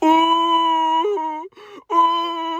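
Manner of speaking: very high, drawn-out fake wail; weird mock cry. Mimicking their hungover morning crying, weird cry. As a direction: imitate a strange high crying moan. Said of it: "such a weird cry".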